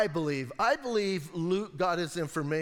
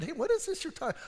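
Jesus asking gently; 8 semitones higher, faster than usual. Retold or imitated. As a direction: lightly voice Jesus drawing them out. Jesus voiced asking the disciples.

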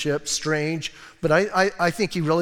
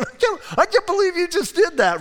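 very high, loud disbelief; high shocked squawk; shocked Haman; 13 semitones higher, 4 dB louder than usual. Retold or imitated. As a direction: squeal it in horror.

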